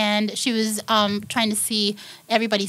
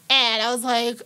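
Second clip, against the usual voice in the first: ordinary talk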